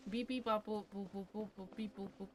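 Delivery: soft, fading robot beeps; beeping noises. Pretend. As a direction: beep and boop like a toy robot.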